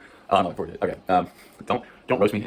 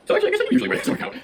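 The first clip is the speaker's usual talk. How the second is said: laughing; 5 dB louder, faster than usual. Real, not acted. Laughing at herself.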